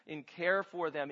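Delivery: ordinary delivery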